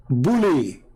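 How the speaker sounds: ordinary delivery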